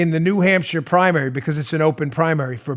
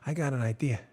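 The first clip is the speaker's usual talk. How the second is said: Vito Corleone; 6 semitones lower, 13 dB quieter than usual. Retold or imitated. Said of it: "you got your Vito Corleone voice?".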